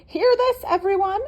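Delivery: very high, loud goose cry; shouting. Acted. Gunther on the hay bales calling the barnyard, shouting. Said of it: "shouting to get attention".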